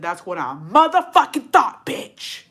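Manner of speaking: loud, emphatic acted comeback; forceful mock-triumphant retort; triumphant jab. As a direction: punch it out hard, breathy last word. Retold triumphant jab at husband.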